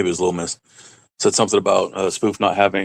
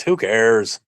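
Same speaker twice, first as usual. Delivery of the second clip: ordinary delivery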